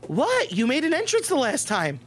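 high-pitched defensive retort; squeaky, indignant character voice; Katie. Acted. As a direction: jump up high on a protesting comeback. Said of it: "And Katie goes".

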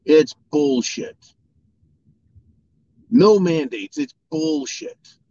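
a hammed-up DeSantis banning lockdowns; defiant governor. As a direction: spit it out like a fed-up governor laying down the law.

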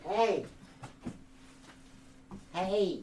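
soft, gentle call to a sleeper; waking a sleeping friend, soft and gentle; gentle wake-up. Real, not acted. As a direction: softly nudge him awake. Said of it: "See, being gentle works".

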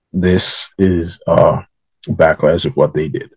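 usual speaking voice

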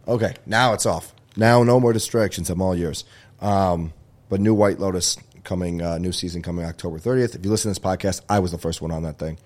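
ordinary talk

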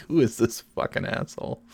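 hushed disgusted whisper from the crowd. Retold or imitated. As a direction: mutter it like an annoyed onlooker. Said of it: "everybody else was like".

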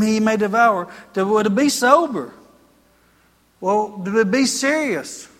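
fervent preaching. Acted. Pastor urging the congregation to be vigilant, loud and fervent.

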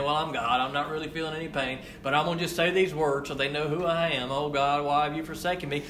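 offhand, quick and hushed, a lightly voiced Jesus going through the motions; mock casual Jesus. Preacher mocking a Jesus who only pretends, quick and offhand. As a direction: toss off the words like a show, half-hearted. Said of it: "hanging up there saying".

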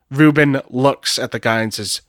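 ordinary delivery

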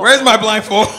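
high, loud, laughing through it; laughing mock panic. Cohost echoing the joke, laughing in mock panic. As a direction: laugh while crying out for a blindfold.

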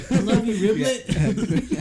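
high, drawn-out fangirl cry through laughter; gushing fan. Pretend. Fan telling the DJ his new name, hammed-up gushing. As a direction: call it out high and slow, hammed-up adoration. Acting out fan calling to performer.

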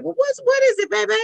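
high, cooing grandma answer; doting grandmother. Retold or imitated. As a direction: answer a calling child in a high voice. Grandmother answering the calling child.

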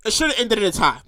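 normal talking voice

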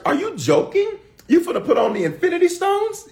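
very high, laughing incredulous squeal; mock disbelief. Real, not acted. Preacher mocking stone-seekers, very high laughing disbelief. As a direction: squeal at the absurdity.

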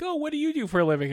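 very high, fast bright question; mock chirpy host. Imagined comic asking crowd member, high fast chirp.

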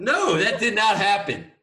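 loud, high, drawn-out protest; amused, shouted objection; laughing denial. Real, not acted. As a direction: protest through a laugh at a friend's teasing. Laughing denial of friend's teasing story.

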